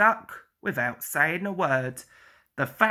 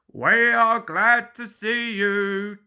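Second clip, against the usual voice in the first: slow, louder welcoming; warm welcome; 3 semitones higher, 5 dB louder than usual. Acted. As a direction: welcome them gladly. People welcome engines.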